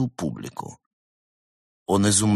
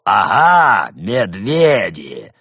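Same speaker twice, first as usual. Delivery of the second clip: leader voice; 7 dB louder, much slower than usual. Acted. Аннибал leader mocks.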